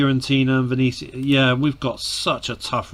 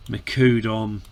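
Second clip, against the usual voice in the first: ordinary talk, nothing marked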